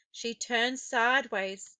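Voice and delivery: ordinary delivery, nothing marked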